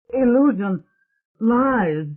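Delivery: high, loud defiant cry. A narrator's reading. Michael crying out against the ghostly vision, high and loud. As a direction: fling the words at the illusion. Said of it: "he cried".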